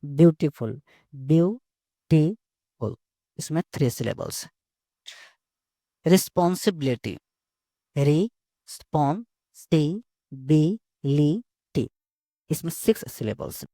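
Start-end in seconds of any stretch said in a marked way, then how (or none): 1.1-2.9 "Beautiful" very slowly as beau, ti, ful; syllable by syllable. Shown as a demonstration. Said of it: "किसी word को धीरे-धीरे बोलना है". 8.0-11.9 "Responsibility" very slowly in six separate beats; re, spon, si, bi, li, ty, pausing after each in "Responsibility"; syllable by syllable. Shown as a demonstration. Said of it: "किसी word को धीरे-धीरे बोलना है".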